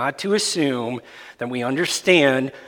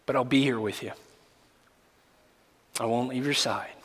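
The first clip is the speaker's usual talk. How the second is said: tender reassurance; 2 semitones lower, 9 dB quieter, faster than usual. Shown as a demonstration. Gentle words to grieving friend.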